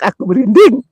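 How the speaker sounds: high, loud nervous laugh; giggling with nerves; nervous laughter. Real, not acted. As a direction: laugh through goosebumps.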